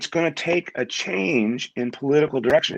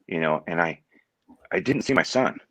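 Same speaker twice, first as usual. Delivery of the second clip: ordinary talk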